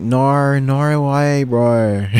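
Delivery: a quick jokey Aussie impression; Australian accent. Shown as a demonstration. Showing viewers her Aussie accent, hammed up. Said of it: "more Australian and British for sure".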